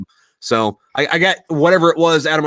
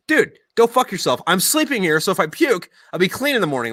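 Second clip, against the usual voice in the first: high-pitched retold protest; indignant comeback; 6 semitones higher than usual. Buddy defending his spot in the shower, indignant comeback. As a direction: argue back in a raised pitch.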